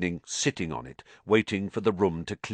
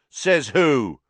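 loud, higher-pitched retort; loud challenge; 6 semitones higher, 8 dB louder than usual. A narrator's reading. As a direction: fire back loudly in disbelief. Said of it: "said Dean Thomas loudly".

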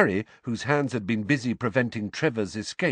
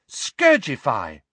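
spell incantation; 2 semitones higher, 5 dB louder, slower than usual. Acted. Casting a cleaning spell.

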